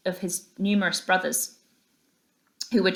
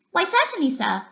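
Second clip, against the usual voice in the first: much higher, polite little voice; respectful mouse; 10 semitones higher than usual. A narrator's reading. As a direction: answer the gentleman politely. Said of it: "replied the first field mouse respectfully".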